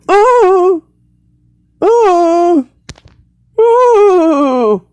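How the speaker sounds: gasp, then high falling "ooh" cries; hammed-up melting wails; high mock wails. Witch hit by water, high mock wails.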